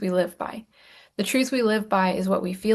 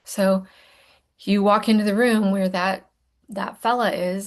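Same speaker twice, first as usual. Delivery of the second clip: usual speaking voice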